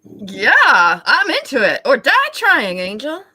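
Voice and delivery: booming, heroic overacted vow; loud heroic declaration. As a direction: declare it like a film hero. Loud heroic roleplay lines.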